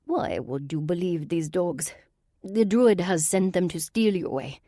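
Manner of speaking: put-on an aristocratic villain in a Russian accent; Russian-accented sorceress.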